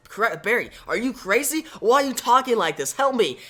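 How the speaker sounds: high, alarmed disbelief; can't believe your friend is saying this; shocked disbelief. Acted. Wally stunned by Barry's betrayal, alarmed and pleading.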